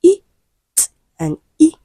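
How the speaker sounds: "E", "T", "E" as separate sounds, with pauses between; isolated speech sounds. Shown as a demonstration. Teacher counting rhyming sounds from the last, each of "E", "T", "E" sounded separately. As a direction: sound out "E", "T", "E" one at a time. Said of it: "three sounds that rhyme from the last".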